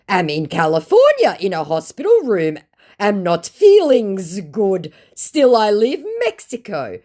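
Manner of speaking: high, loud put-on voice; hammed-up pitiful excuse; mock sick scammer. Scammer claiming he's ill in hospital in a high mock voice.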